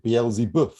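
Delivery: Beelzebub with an odd French-sounding ending; imitated mispronunciation. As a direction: mimic the misread Beelzebub, last syllable like "boeuf". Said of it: "You pronounced it Beelzebub".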